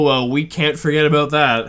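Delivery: usual speaking voice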